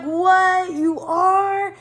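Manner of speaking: surprised. Retold or imitated. To the driver getting married soon, loud surprise. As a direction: blurt it out in shock. Reacting to driver's wedding news.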